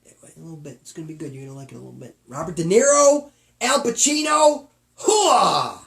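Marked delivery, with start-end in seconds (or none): 2.3-5.8 booming high soldier shout; loud gung-ho soldier. Retold or imitated. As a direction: bark it like a fired-up Marine. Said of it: "Jay just went all Marine on us".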